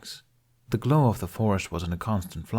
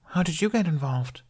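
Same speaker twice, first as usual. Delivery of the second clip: put-on high curious little boy; a sleepy kid asking; voiced young boy; young boy Jamie; 9 semitones higher than usual. Child character voice.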